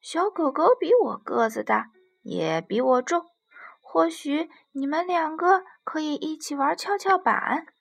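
loud, bouncy voice of a monkey with an idea; eager little monkey. Acted. Monkey suggests the giraffe ask the puppy, eager and loud. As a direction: burst out with a helpful suggestion.

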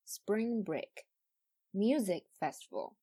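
slow, stress on spring and music; slow careful model of compound stress; front stress model. Shown as a demonstration. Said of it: "一定要说spring break".

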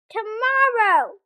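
loud, slow, drawn-out cry. Acted. Toad to Frog, refusing the chores, slow loud cry. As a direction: wail the word out in protest. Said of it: "cried Toad".

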